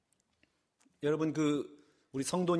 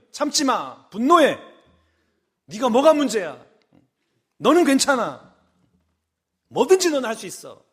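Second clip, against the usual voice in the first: egging devil voice; 5 semitones higher, 5 dB louder than usual. Acted. Devil urging believer, voiced by preacher.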